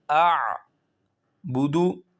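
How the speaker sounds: اعبدو slow, ع clearly voiced in throat; correct ain. Shown as a demonstration. Teacher giving the correct reading slowly. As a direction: stretch اعبدو, ع distinct from hamza. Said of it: "ایک حمزہ ہے اور ایک عین ہے".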